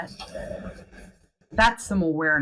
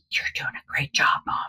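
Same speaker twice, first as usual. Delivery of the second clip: whispered encouragement to a stranger; 4 semitones lower, faster than usual. Retold or imitated. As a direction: lean in and whisper kindly. Encouraging a struggling mom in public.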